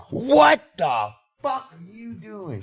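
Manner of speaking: high, slow, disbelieving mom; mom's shocked outburst. Retold or imitated. Mom catching her son smoking at dinner, shocked outrage. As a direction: stretch it out in stunned outrage. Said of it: "she's like".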